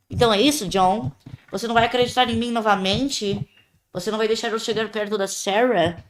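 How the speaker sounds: building from bitter taunts to furious shouting; angry accusation. Pretend. Voices dead Penny blaming John.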